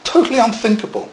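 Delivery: Totally unthinkable, thrown up high; emphatic. Real, not acted. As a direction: lift the whole phrase up in disbelief. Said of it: "Unthinkable from their culture".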